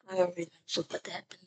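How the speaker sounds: very soft fast mutter; muttered under breath. Real, not acted. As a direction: mumble it quickly to yourself. Mumbled aside.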